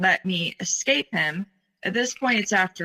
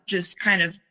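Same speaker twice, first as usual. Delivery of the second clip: usual speaking voice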